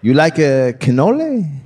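hammed-up fake Italian question; off-target Italian accent. Deserter trying to pass as Italian, dodgy accent. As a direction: badly fake an Italian offering food. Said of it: "your accent sounds quite off".

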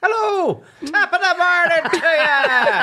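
high, slow stage Irish greeting, laughing; cartoon Irish brogue through laughter; mock Irish accent. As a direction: ham up an Irish lad. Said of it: "He's a lovely Irish lad".

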